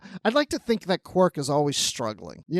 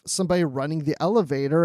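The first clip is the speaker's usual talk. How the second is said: normal talking voice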